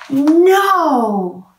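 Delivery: put-on loud sharp child's refusal; a boy's loud flat refusal; defiant no. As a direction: stamp it out like a stubborn kid. Ned defies his mom.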